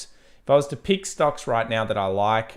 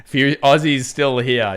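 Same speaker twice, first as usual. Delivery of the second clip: laughing; 5 semitones higher, 10 dB louder, slower than usual. Real, not acted. Laughing while reading chat.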